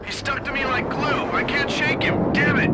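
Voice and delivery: rattled pilot under fire; panicked pilot. Acted. Pilot chased by enemy jet.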